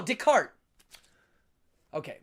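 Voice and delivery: ordinary delivery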